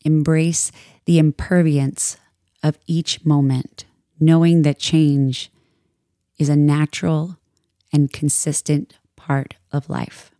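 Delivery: calm, measured meditation voice with long pauses; soothing yoga teacher guiding a meditation; guided meditation. Acted. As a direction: ease listeners into stillness, unhurried and even.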